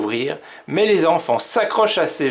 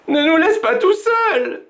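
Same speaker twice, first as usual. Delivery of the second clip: voix d'enfant très aiguë, paniquée; cri affolé; 3 dB louder than usual. A narrator's reading. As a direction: crier de ne pas être laissé seul. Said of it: "crie-t-il affolé". (enfant affolé, cri aigu)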